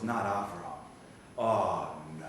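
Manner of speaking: low, hushed dismay with pauses; quiet dread. Acted. Satan sees Avram walk in, quietly dismayed. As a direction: let it sink in softly.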